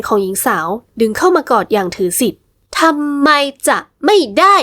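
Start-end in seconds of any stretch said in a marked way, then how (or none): 2.6-4.5 slow, each word ทำไม จะไม่ได้ set apart and higher; a woman's defiant question, every word weighted; each word stressed. A narrator's reading. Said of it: "หญิงสาวเน้นทีละคำพูดช้าๆ แต่ชัดเจน".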